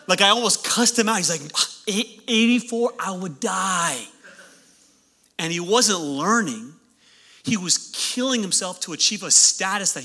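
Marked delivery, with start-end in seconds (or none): 1.9-4.0 slow, stammered overdramatic dismay; hammed-up horrified student; mock horror. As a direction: act aghast, dragging out the words. Classmate horrified at low score.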